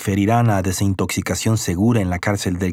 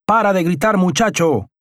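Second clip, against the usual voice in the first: shouting; 8 semitones higher than usual. Acted. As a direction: yell it down the cell block like an annoyed guard. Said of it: "El oficial gritó".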